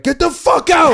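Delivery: very high, loud yell; furious teacher imitation. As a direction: imitate a teacher throwing a student out. Retold substitute throws student out.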